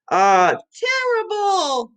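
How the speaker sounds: high, slow "Oh, terrible" in hammed-up dismay; mock dismay. Teacher acting out feeling terrible for the class. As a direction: play up the awfulness for kids.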